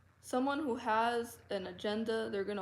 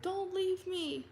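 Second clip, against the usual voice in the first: mock begging; 10 semitones higher, slower than usual. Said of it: "He's like".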